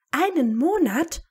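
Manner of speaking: high, loud squeal of disbelief; astonished shriek. A narrator's reading. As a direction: echo it back in shrill astonishment. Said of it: "kreischte Hermine erstaunt".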